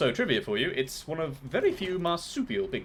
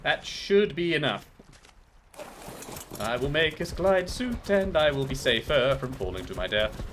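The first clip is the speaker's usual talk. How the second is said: usual speaking voice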